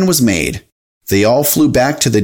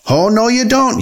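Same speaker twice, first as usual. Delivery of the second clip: high-pitched, alarmed refusal; alarmed cry; 10 semitones higher than usual. A narrator's reading. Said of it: "he cried in alarm".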